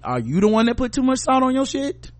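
sarcastic high scolding question; mock scolding.

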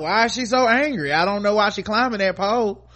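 hammed-up innocent confusion; mock bafflement. Mocking clueless man's reaction.

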